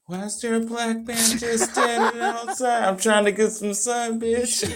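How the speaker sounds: high-pitched comic re-enactment; hammed-up high voice for laughs; high mock outburst. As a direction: squeal it out as a jokey imitation. Acts out sunbathing exchange with onlookers.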